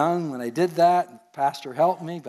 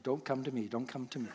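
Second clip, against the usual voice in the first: hushed nervous plea; 3 semitones lower, 12 dB quieter than usual. Retold or imitated. Retold nervous thought in audience.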